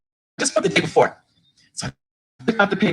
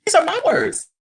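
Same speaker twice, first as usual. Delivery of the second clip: amazed exclaim; 12 semitones higher than usual. Retold or imitated. Stunned, hearing granddaughter laugh.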